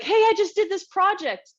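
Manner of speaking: put-on a kid proudly showing off a project; eager kid. Child tells parents about a project, high and eager.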